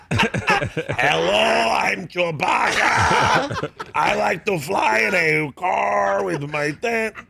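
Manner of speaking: drawn-out, high shout in a hopelessly wrong creature voice; clueless Chewbacca impression. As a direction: imitate someone badly faking a movie creature, bellowing every word slowly. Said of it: "can you do an impression of Chewbacca".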